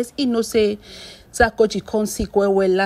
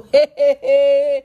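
loud he-he-he laugh; amused cackle; 5 dB louder than usual. Real, not acted. Vlogger laughing at a husband's complaint, amused cackle. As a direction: laugh in a quick, loud run.

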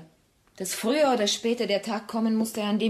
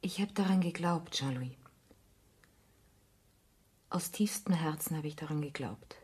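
hushed, breathless whisper of lost faith; barely voiced, holding back tears; 6 dB quieter than usual. Carol confessing to Jean-Louis, whispered.

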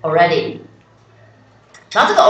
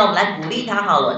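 ordinary talk, nothing marked

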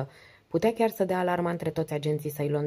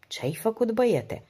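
raised, higher-pitched reproachful question; 7 semitones higher than usual. A narrator's reading. Sir Robert questions Don, reproachful. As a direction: take the young man to task, pitch lifted. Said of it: "privindu-l mustrător".

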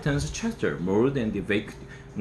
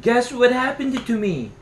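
excited; 11 semitones higher than usual. Acted. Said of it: "cried Toby".

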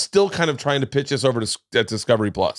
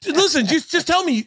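high, laughing, pleading voice; squeaky giggling plea. Real, not acted. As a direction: beg through a laugh. Excited pitch while laughing.

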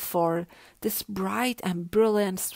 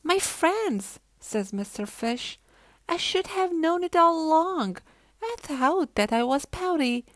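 excited; 7 semitones higher, 4 dB louder than usual. A narrator's reading. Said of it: "speaks at last".

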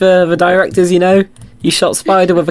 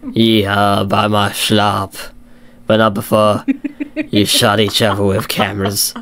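low-pitched duck impression with a put-on accent; badly faked accent, pitched down low; Flezzy; 6 semitones lower than usual. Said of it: "do my Flezzy impression here".

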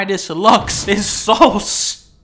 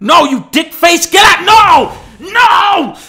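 loud, much higher furious shout; furious yell; 9 semitones higher, 9 dB louder than usual. Pretend. Streamer yelling at the enemy who ruins his trap, furious shout. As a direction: scream as the whole plan falls apart. Screaming as the plan fails.

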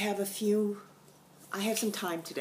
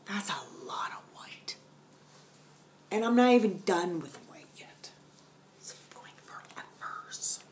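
whispered aside; 3 semitones higher than usual. Real, not acted. Whispered asides about work.

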